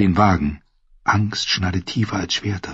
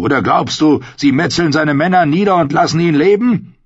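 higher, loud angry retort; angry shout; 7 semitones higher, 4 dB louder than usual. Acted. Clegane shouts at Arya amid the battle, loud angry retort. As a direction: throw it at her over the din.

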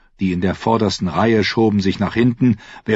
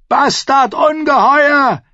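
high, loud hateful yell; roaring; 5 dB louder, slower than usual. A narrator's reading. Someone in the mob to the king, roaring insults. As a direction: bellow both insults, stretching the second. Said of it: "brüllte jemand Joffrey zu".

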